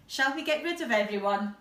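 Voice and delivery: usual speaking voice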